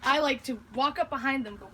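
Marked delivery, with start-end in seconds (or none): none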